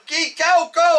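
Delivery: high, urgent mock yell; retold yelling. Pilot yelling at a driver to move, high retold yell. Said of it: "I'm yelling".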